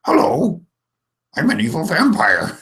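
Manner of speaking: deliberately bad character voice for a vampire; Hoggle. Shown as a demonstration. Game master demoing a bad voice, hammed-up vampire. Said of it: "your bad voice is like Hoggle".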